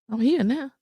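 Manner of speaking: laughing, carefree boast; playful confidence. Retold or imitated. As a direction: tease him, you're fine. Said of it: "I just was like".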